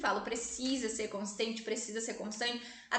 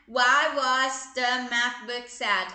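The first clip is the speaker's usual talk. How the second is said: very slow and louder, each word laid out; riddle for a child; 7 dB louder, much slower than usual. Shown as a demonstration. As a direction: pose the riddle slowly to a little kid. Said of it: "a mamãe vai colocar um enigma".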